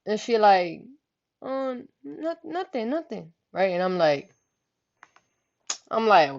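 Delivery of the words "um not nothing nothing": quiet denial. Retold or imitated. Cousin denies anything wrong.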